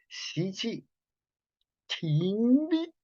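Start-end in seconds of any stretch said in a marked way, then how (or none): 2.0-2.9 "Timbi" said high and rising; name the term "Timbi" with a lifted, held tone; demo term. Shown as a demonstration. Teacher demonstrating the breath-pause term, raised pitch. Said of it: "volvemos a repasar hasta ahora".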